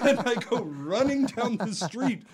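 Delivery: usual speaking voice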